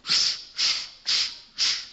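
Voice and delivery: unvoiced shushing squeaks of chalk on a board; chalk squeak noise. Boy at the blackboard drawing a square, squeaking chalk. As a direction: make the chalk squeak on the blackboard, breathy and voiceless. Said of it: "he kinda went".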